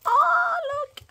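high, loud coo of delight; delighted squeal. Real, not acted. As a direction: squeal it like seeing something adorable. Delighted by pouch detail.

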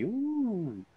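high, very soft "ooh"; mock scheming coo. Podcaster mimicking people out to monetize a scandal, mock-tempted. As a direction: coo it high and quietly, imitating an opportunist.

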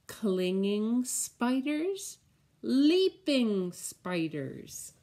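slow, sing-song picture book read; reading to children. Acted. Teacher reading the spider book to children, slow and sing-song. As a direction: savour each spider word for little ones.

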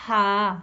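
हा breathed from the throat, thin; demonstrating the throat letter ha; throaty ha. Shown as a demonstration. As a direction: push air from the throat for हा. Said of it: "तो हम इसको पढ़ेंगे".

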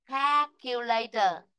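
"calculator" slowly with a long æ first vowel; careful teacher's "calculator" with spread first vowel; æ pronunciation. Shown as a demonstration. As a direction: model "calculator", jaw dropped on "kae". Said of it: "khẩu hình miệng chúng ta vẫn hạ ra".

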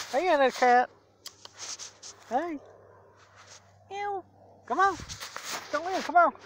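high sweet cooing call to a cat; pet talk. Real, not acted.

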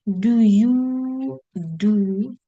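extremely slow, each word stretched; slow-motion teacher prompt; drawn-out prompt. Shown as a demonstration. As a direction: hold "you" long, then stretch "do". Spells out blank for students.

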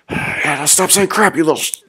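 high, loud exasperated outburst, cut off; snapping. Retold or imitated. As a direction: re-enact losing all patience with a kid. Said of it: "but when I snap".

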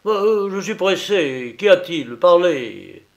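brusque et plus fort, impatient; le ministre pressé qui coupe court; ton rogue. A narrator's reading. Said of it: "demanda d'un ton rogue". (le ministre, agacé, exige qu'on parle, ton rogue)

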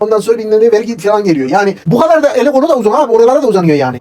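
high, loud, worked-up accusing; heated accusation. Acted. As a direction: rail against him, all worked up.

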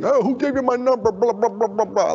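put-on a high, put-out teen snapping at a stranger; indignant. Teen boy on the phone, indignant and high. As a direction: sound offended that anyone called.

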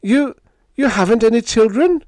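very high, loud, stammered question; incredulous. Acted. Biggs asking Helen about children, incredulous. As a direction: blurt it in astonishment.

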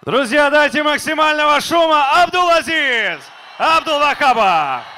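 high shouting crowd hype; hype man shout. Real, not acted. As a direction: pump up the crowd, loud and high. Hype man calls for crowd noise.

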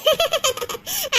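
short, soft laugh; a light relieved laugh; soft happy laugh. Real, not acted. Friend laughing softly as the other stops crying.

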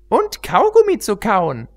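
very high, bouncy pony voice; giddy Pinkie Pie. Acted. Pinkie Pie blurting a joke mid-standoff, very high. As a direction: pop the joke out high and silly.

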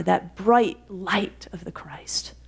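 slow, whispered reverence; preacher to the congregation, on the light of Christ, hushed. Real, not acted. Preacher closing a reflection.

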